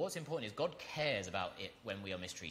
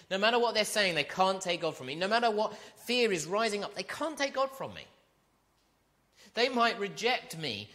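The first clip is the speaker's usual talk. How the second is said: rising, impassioned build, louder and higher; preaching at full force; 8 semitones higher, 8 dB louder than usual. Acted. Preacher to congregation, fervent rising declaration. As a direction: preach it with mounting fire.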